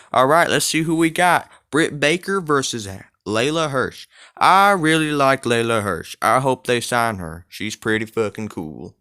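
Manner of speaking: lightly voiced Southern US accent, hyping the crowd. Host introducing the women's match in a light southern accent. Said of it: "I'll stop doing the southern accent now".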